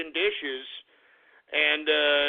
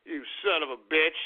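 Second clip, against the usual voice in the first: ordinary delivery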